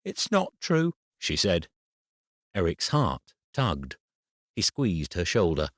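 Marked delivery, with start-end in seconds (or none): 0.0-0.9 put-on much higher, trembling child's voice; a small girl on the edge of tears; tearful little girl. A narrator's reading. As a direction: daughter denying it, voice near crying. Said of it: "her eyes were watery and her lips trembled".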